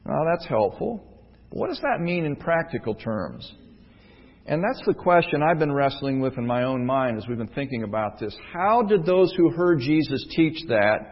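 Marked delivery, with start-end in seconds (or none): none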